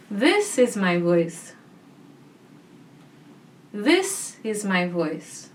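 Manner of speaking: "This" hit first, the rest falling away; stress on This. Shown as a demonstration. Voice teacher demonstrating different emphasis, stress on "This". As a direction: lean on the word "This". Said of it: "let's try emphasizing different words".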